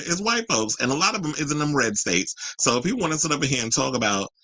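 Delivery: normal talking voice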